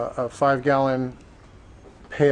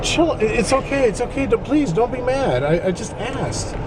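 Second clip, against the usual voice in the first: soothing plea; 8 semitones higher than usual. Retold or imitated. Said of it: "there's no reason to be upset".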